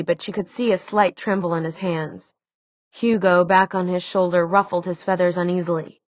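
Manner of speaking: plain reading voice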